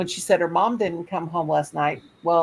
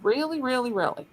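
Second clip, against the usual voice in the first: mock disbelief; 7 semitones higher than usual. Reacting to the neighbor's inaction, mock disbelief.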